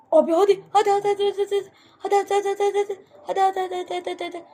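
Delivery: rapid high chant of hadi, rising stakes; pleading with the game, pitch way up; frantic urging. Real, not acted. Gamer begging his character to make the jump, frantic high chant.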